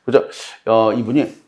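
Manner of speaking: usual speaking voice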